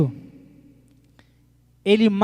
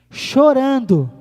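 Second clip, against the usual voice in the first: "Chorando" slow, louder and higher; drawn-out, forceful "Chorando"; emphatic repeat; 4 semitones higher, 7 dB louder, much slower than usual. Shown as a demonstration. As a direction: stretch and punch "Chorando". Repeats the key word with emphasis.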